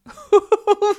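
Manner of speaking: very high, loud burst of laughter; taunting laugh. Acted. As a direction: cackle loudly at their helplessness. Mistress laughs at the struggle.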